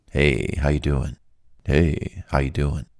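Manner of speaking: low, relaxed phone greeting; soothing vibe. Shown as a demonstration. Caller putting a company agent at ease, low and soothing. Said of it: "All I do is I say this".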